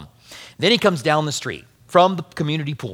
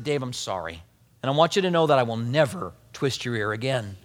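much softer, lower, gentle apology; hushed, earnest apology to a child; 6 semitones lower, 6 dB quieter than usual. Retold or imitated. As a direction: say sorry quietly to the boy. Father apologizing softly to son.

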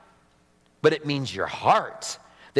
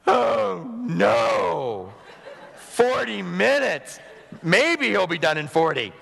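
exaggerated groan of dismay with a laugh; mock dismay; 3 semitones higher, slower than usual. Preacher mocking a listener's dread at a long sermon, laughing groan. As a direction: playfully moan at a long sermon. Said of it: "you're just like".

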